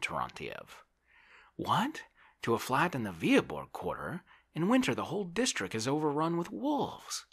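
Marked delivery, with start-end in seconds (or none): 1.6-7.2 high, loud incredulous outcry; high-pitched, scandalised protest; aghast landowner. A narrator's reading. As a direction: recoil at an outrageous suggestion. Oblomov is aghast.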